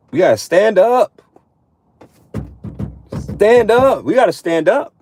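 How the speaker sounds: rally the brothers to rise; emphatic call to stand up. Real, not acted. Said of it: "rallying call".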